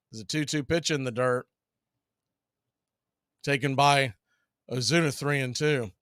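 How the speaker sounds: steady play-by-play announcer read; play-by-play call. Acted. Announcer calling the count to the stream. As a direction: call the pitches like a broadcaster.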